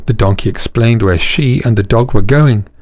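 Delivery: usual speaking voice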